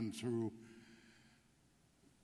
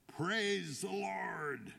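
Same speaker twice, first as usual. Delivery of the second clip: high, stretched-out cry to urge a horse on; long, high-pitched urging cry; drawn-out call; 8 semitones higher, much slower than usual. A narrator's reading. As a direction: call it out slow and high, riding hard. Rider urging horse to gallop.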